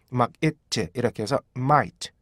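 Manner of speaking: ordinary delivery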